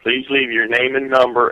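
ordinary delivery

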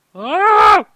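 loud, high hello; shouted hello. Real, not acted. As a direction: yell it down the line. Shouting hello into dead line.

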